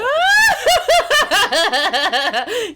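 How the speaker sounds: loud, high shriek of laughter. Real, not acted. Reacting to the goth witch story, a shrieking laugh.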